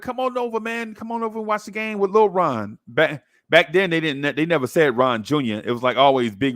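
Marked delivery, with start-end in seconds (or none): 0.0-2.8 high, friendly call to come over; neighbor inviting. Retold or imitated. Neighbor inviting the kid to watch the game, high and friendly. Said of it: "And he's like".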